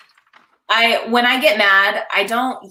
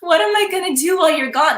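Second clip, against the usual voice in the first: high-pitched, mock helpless plea; feeling victim; 9 semitones higher than usual. Newlywed wife to departing husband, mock victim. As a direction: plead it as a put-on poor-me. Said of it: "more victim".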